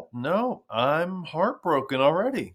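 high, slow mock lament; badly faked sorrow, drawn out; fake heartbreak. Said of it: "having a hard time emoting".

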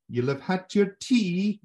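high, loud, drawn-out Scottish; Scottish accent. Shown as a demonstration. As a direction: classic catchphrase in a put-on Scottish accent. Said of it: "there's the classic line".

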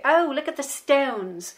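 put-on slow, high American kid accent; mock American accent, drawn out slowly; imitating American kids. Child imitating American kids at the lake, slow high American accent. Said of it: "trying to imitate the way they spoke".

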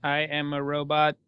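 flat, low robot voice. Acted. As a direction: speak like a droning machine. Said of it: "I said it in a robot voice".

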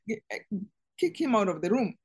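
normal talking voice

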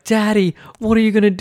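high-pitched mock-worried child; alarmed little girls. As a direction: imitate kids fretting at their dad. Daughters watch dad botch haircut.

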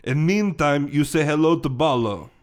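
put-on halting Russian-accented henchman; broken English with a Russian accent. Henchman introducing the detective to Ballo, Russian accent. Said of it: "he's Russian, by the way".

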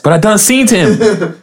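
high, slow twangy insistence; hammed-up backwoods drawl. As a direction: dig in like a stubborn yokel. Player pushes the yokel voice.

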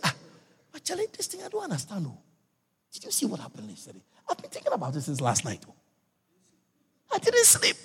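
soft, worried murmur about last night; troubled hush. Acted. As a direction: confide quietly, unsettled. Troubled brother talks at night.

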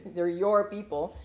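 slow, dry "your people"; a touch of sarcasm on your; sarcastic. Retold or imitated. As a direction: hand them back with a dry jab. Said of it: "he repeats it sarcastically back".

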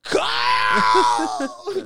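very high, loud, drawn-out yell; imitating Dad's desperate holler; screaming like dying. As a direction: scream the name as if in mortal danger. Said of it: "scream my name like you're dying".

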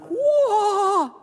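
put-on very high, loud childlike whoa; Buddy's amazement. Buddy the Elf marvelling at New York, loud whoa. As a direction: blurt out pure wonder at the city. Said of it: "And he's like".

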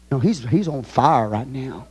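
ordinary talk, nothing marked

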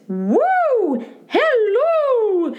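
very high falsetto whoop and hello; head voice demo. Shown as a demonstration. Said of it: "that's what head voice is".

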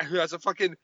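normal talking voice

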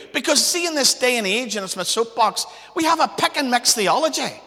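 loud, rising, fervent sermon voice; full-force preaching. Acted. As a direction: preach it out, building to the top.

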